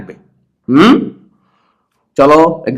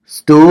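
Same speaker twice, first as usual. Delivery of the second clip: Stew said long and forcefully; stressed syllable. Shown as a demonstration. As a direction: push stew out firmly. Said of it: "একটু জোরে বলবা".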